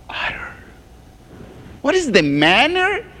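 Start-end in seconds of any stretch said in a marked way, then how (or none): 0.1-0.5 whisper. Acted. Scripture read about unutterable groanings.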